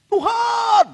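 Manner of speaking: high, loud, desperate cry of "Tuhan!"; imploring shout to the Lord; crying out to God. Retold or imitated. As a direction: call out to God in anguish. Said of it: "kita teriak".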